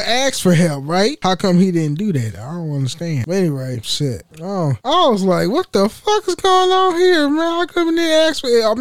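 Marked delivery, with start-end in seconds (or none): 5.5-8.8 high, mock-outraged disbelief; retold fed-up shout, pitched way up; exasperated outburst. Venting to viewers about coworker, high exasperated outburst. Retelling own outraged reaction.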